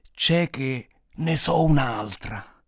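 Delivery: put-on very slow old man, each word hauled out; dragged-out words. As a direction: drag the words, long gaps between. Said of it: "trascinando le parole così lentamente".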